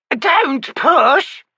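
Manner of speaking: put-on high, loud old woman's shriek. Witch shrieking at the wizard through the door, high loud shriek. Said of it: "a voice shrieked behind it".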